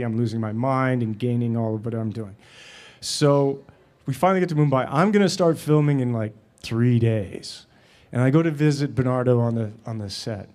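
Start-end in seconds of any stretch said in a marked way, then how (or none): none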